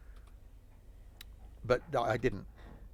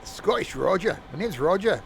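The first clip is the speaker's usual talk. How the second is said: higher-pitched, badly faked Scottish accent; 5 semitones higher, 3 dB louder than usual. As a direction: attempt a Scottish voice and miss. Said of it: "I am no good at accents".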